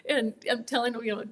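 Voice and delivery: usual speaking voice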